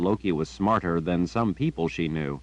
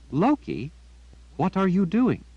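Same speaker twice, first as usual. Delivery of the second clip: put-on girl's voice, a touch higher and puzzled; Jillian, puzzled; 11 semitones higher than usual. A narrator's reading. Girl asking her raven, lightly voiced puzzlement. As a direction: ask the bird what it's up to, lightly voiced.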